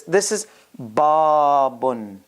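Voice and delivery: babun, slow, with the double n sound held; nunation demo. Shown as a demonstration. Teacher modeling babun for students, slow and deliberate. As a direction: say babun slowly, stretching the final un. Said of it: "remember double n sound".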